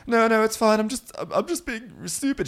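high, weepy imitation brushing it off; put-on crying falsetto; mock tearful. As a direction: squeak it out as if crying.

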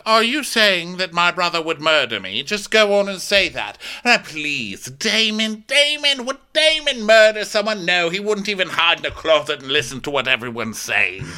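high-pitched mock disbelief; incredulous defence. King defending his brother to the council, incredulous.

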